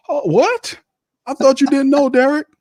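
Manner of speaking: high fake gasp of surprise; mock surprise. Calling out Carr's lie, mock surprise high. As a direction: act shocked, high and sarcastic.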